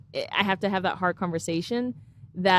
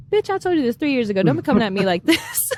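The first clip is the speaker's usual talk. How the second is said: higher, fast and sassy; mock diva attitude, higher and faster; sassy told-you-so; 6 semitones higher, 4 dB louder than usual. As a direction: snap it like you've had enough. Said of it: "doesn't sound like".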